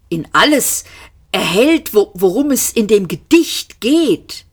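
lightly voiced cry; mild shout. A narrator's reading. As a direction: call it out, halting over the words. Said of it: "schrie er".

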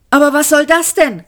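high, quick exclamation; crying out. A narrator's reading. Arthur baffled by what Ford said, crying out. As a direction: call out fast and high. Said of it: "rief Arthur".

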